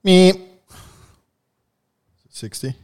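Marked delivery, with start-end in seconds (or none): 0.0-0.4 sharp loud meep; loud high meep. Acted. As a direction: blurt a quick buzzer meep. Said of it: "That's my buzzer".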